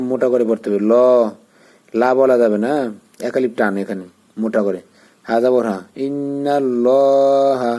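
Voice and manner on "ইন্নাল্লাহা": ইন্নাল্লাহা drawn out very slowly with a full rounded heavy lam; Quranic recitation. Shown as a demonstration. Teacher reciting the word for students in tajweed style. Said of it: "মুখ গোল হবে".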